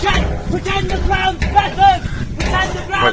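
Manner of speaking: barked drill commands, loud; shouting orders. Real, not acted. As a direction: yell it like a drill sergeant. Drill instructor yelling at contestant.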